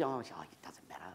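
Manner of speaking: modest whispered brush-off; whispering. Retold or imitated. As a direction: breathe it away, shy and hushed. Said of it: "And she".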